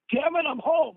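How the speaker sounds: hammed-up annoyed father yelling; mock grumpy dad. Dad walks in on drumming, mock grumpy shout.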